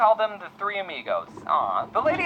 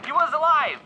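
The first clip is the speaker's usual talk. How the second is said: excited reveal; 7 semitones higher, 4 dB louder than usual. Acted. Twist after faked death.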